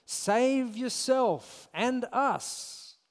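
slow, high sneering taunt; sarcastic. Acted. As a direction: drag out the sneer. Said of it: "His words dripping with sarcasm".

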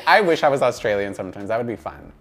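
normal talking voice

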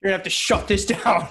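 usual speaking voice

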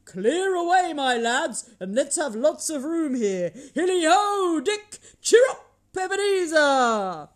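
high, loud, hearty calls; rally the lads at full voice; jovial shouted orders. A narrator's reading. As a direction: exuberant hollering boss. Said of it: "cried old Fezziwig".

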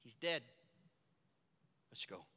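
soft, low and final; a hushed, settled Jesus; quiet resolve. Acted. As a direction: say it quietly, decided.

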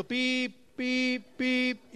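beeping like a heart monitor; mock monitor beep. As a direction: imitate the machine's beep. Imitating the hospital monitor beep.

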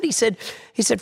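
ordinary talk

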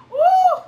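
high, loud exultant cry; joyful worship whoop. Real, not acted. Preacher praising God lets out a joyful high whoop. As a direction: let out a burst of praise.

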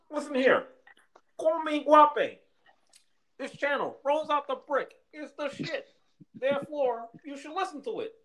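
high puppet frog voice; chirpy high cartoon frog; Kermit the Frog voice. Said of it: "I could do a fucking mean ass Kermit".

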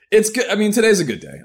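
forced cheer. Real, not acted.

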